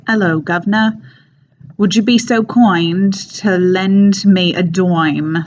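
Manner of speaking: broad Cockney street scrounger. Acted. Demonstrating a scruffy Cockney comic character begging, Cockney accent. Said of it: "It's like a smelly guy".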